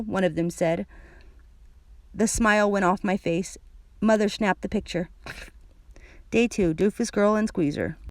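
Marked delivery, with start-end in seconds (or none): none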